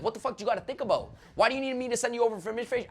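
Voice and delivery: fast, high, exasperated snapping at the client; emotional outburst. Retold or imitated. Salesman losing patience with a hesitant client, exasperated and fast. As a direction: let the frustration boil over and rush it. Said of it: "getting emotional".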